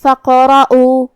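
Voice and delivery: level chant of فقرؤ, popped q and drawn final oo; echoed qalqalah. Shown as a demonstration. Teacher demonstrating qalqalah on qaf, slow tajweed recitation. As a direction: slowly recite فقرؤ with a bouncing echo on qaf. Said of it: "حرف کو ہلاتے ہوئے".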